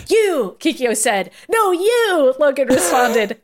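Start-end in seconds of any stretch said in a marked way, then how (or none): 0.1-0.6 high, exclaimed anime callout; hammed-up confrontation cry; dramatic accusation. A narrator's reading. Acted anime face-off. 1.5-2.3 high, emphatic comeback; hammed-up anime counter-accusation; dramatic retort. A narrator's reading. Acted anime face-off retort.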